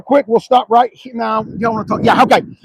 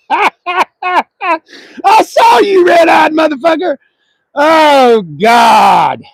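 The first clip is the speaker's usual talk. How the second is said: loud, high shout across the lot; loud high call-out; 9 semitones higher, 5 dB louder, much slower than usual. Real, not acted. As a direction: yell at the guy you caught staring. Yelling at a passing car.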